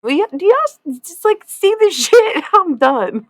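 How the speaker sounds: high, loud, fed-up shout; exasperated outburst. Retold or imitated. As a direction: blow up, totally done with it. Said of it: "sometimes I'm like".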